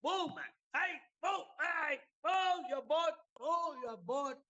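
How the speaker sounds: high, slow yelling at the other boat; angry shout. Retold or imitated. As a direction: bellow across the water to move it. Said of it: "He started shouting".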